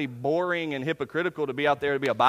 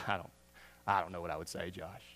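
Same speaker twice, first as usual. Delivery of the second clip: much lower, softer sheepish mumble; mock excuse; 12 semitones lower, 11 dB quieter than usual. Churchgoer excusing himself to Josh, a low sheepish mumble.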